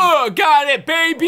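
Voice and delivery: loud, high celebration; excited shout. Real, not acted. Player cheering the play, excited shout. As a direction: crow over the catch, loudly.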